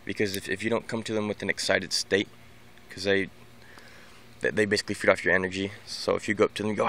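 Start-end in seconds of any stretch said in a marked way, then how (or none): none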